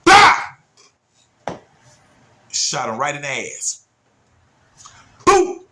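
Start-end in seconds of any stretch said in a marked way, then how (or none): 0.0-0.6 loud, high bang yell; gunshot bang. Retold or imitated. Imitating gunshot. 5.2-5.6 loud, high boom; gunshot boom. Retold or imitated. Imitating gunshot.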